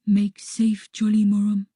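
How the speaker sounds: voiced stage whisper in character; firm, hushed character voice; Harby whispering back. A narrator's reading. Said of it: "he whispers back".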